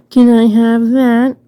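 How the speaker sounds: put-on girl asking the hero sweetly; pretty girl voice. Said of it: "pretty girl comes out of nowhere and says that".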